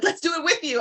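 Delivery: high, bright mock-eager voice of the coworkers; eager colleagues. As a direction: pitch it up like excited coworkers joining in. Colleagues eager to join breathing.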